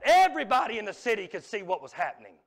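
real shout on EVERYBODY; preacher's shout. Real, not acted. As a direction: belt out EVERYBODY to the whole congregation. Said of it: "I'm about to shout".

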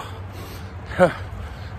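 breathless exhale uphill; heavy breath out. Real, not acted. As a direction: let out a winded breath. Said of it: "I'm going uphill".

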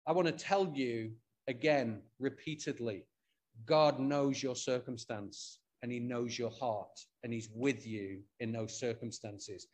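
quiet, tender reassurance to those grieving; reassuring. Real, not acted. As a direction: gently reassure the grieving congregation.

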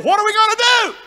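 high, loud panicked cry; mock panic. Grieved Egyptians fret over Israel, mock-panicked shout. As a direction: wail it out in mock alarm.

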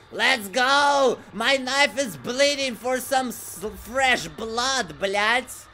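rally the stream, raring to play; excited, impatient holler; hyped-up battle cry. Real, not acted. Impatient mock battle cry while waiting.

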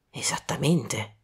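whispered, slow and grave. Acted. Poirot confirming the killer's prints, whispered. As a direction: breathe the word.

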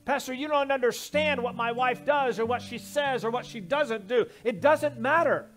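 loud, fast preacher's push over the organ; preaching at full force. Acted. Pastor urging husbands, loud preaching over music. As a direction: drive the point home hard.

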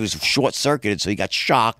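ordinary delivery

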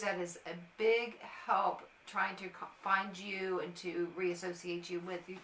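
ordinary delivery

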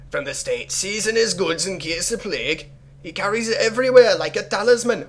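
high-pitched put-on accent, drifting towards Jamaican; bad pseudo-Jamaican accent. Player reading the letter aloud, high pseudo-Jamaican accent. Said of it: "a really bad pseudo-Jamaican accent on occasion".

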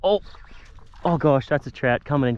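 usual speaking voice

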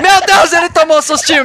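high, loud startled yelp; excited shout. Real, not acted. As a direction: burst out at the scare. Startled by a jump scare.

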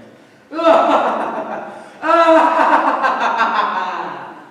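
loud hammy villain laugh; evil cackle. Acted. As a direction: cackle over the victim.

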